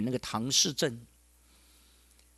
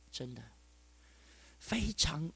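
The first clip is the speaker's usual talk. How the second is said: whispered; 11 dB quieter, slower than usual. Real, not acted. Overcome describing the widow.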